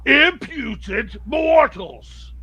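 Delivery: slow, loud, booming god voice; booming arrogant alien overlord. Acted. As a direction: thunder it down from the sky. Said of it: "you just hear this booming voice".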